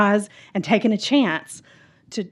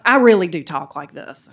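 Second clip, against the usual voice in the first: louder, full natural Southern drawl; 3 semitones lower, 8 dB louder than usual. Shown as a demonstration. Speaker owning how they really talk, Southern drawl. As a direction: let the Southern accent out proudly. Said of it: "I hear my Southern drawl coming out".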